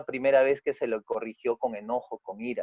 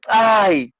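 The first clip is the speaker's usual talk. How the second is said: ay; 5 semitones higher, 6 dB louder than usual. Acted. Son's acted exclamation.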